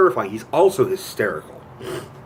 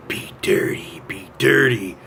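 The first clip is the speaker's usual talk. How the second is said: breathy whispered creep in a theater; heavy breathing; 3 semitones lower than usual. Retold or imitated. Retelling the porno theater scene, heavy-breathing whisper. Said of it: "you hear him breathing heavily".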